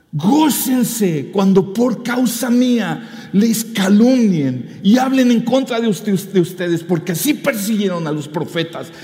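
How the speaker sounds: slow, loud, high scripture reading; proclaiming scripture. Acted. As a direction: declaim the verse like a preacher. Pastor reads Mateo 5 aloud.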